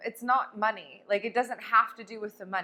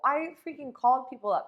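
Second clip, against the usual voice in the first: ordinary talk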